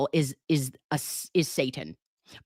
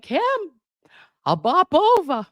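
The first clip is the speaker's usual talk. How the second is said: quoting him; 11 semitones higher, 4 dB louder than usual. Retold or imitated. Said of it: "he was like".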